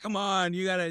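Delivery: high-pitched mock exasperation; angry parent. Imitating parent at any little miss, mock angry. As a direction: imitate a mad sideline parent. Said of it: "like, angry and mad and grunting".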